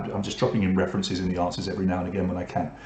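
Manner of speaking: ordinary talk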